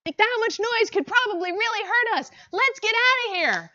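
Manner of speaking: panicked hawk. Acted. Panicked hawk urging escape.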